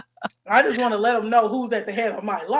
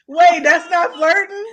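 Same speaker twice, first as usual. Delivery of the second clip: loud laughing disbelief; laughing shout; 6 semitones higher, 6 dB louder than usual. Real, not acted. Laughing shocked reaction.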